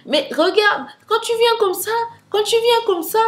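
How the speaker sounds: high, loud directions into the phone. Retold or imitated. Thief shouting directions down the phone, high and loud. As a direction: call out the route to someone lost.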